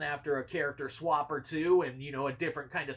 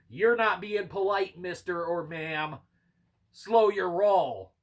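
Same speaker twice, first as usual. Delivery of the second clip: raised voice, slowing right down at the end; mock telling-off; 3 semitones higher, 5 dB louder, slower than usual. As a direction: put a rude player in their place, drawn out. Acts out talking back to players.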